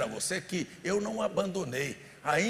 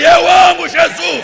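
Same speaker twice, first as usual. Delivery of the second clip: loud, high, drawn-out shout of devotion; rallying shout; 10 semitones higher, 19 dB louder, slower than usual. Shown as a demonstration. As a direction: shout it out to rouse the congregation. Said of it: "grita aí".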